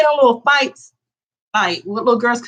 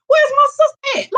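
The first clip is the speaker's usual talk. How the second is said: pitched way up, searching for sister; high frantic call; 3 dB louder than usual. Retold or imitated. Looking for sister mid-fight.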